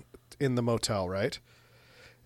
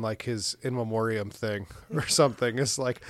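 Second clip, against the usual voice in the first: normal talking voice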